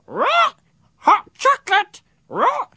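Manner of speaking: very high, screeching parrot voice; shrieky bird cries; parrot squawk. A narrator's reading. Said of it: "she squawked".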